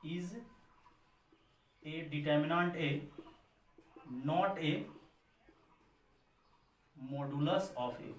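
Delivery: slow, spaced note-taking read; slow dictation. Shown as a demonstration. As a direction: dictate each word slowly for students to copy. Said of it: "फिर से note करके लिख दो".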